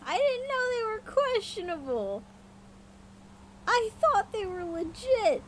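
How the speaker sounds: high, indignant protest; defensive squeal. Acted. Defends against own question.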